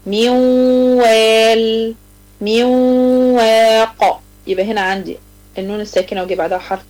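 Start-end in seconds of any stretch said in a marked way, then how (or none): 0.1-1.9 very slow recitation of من وال, the nun melting into a nasal waw; تلاوة بطيئة جدًا مع غنة; nasal merging demo. Shown as a demonstration. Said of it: "لو جينا مثلًا مع الواو". 2.4-4.2 very slow recitation of من وال, the nun melting into a nasal waw; تلاوة بطيئة جدًا مع غنة; nasal merging demo. Shown as a demonstration. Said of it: "لو جينا مثلًا مع الواو".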